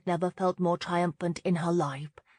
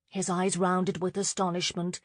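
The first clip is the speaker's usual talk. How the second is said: usual speaking voice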